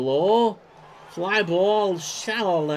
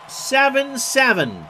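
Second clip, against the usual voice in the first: "seven seven" called out much higher and louder, slowly; excited score call; 9 semitones higher, 5 dB louder than usual. Real, not acted. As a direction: stretch out "seven seven" like a huge moment. Announcing the tied score.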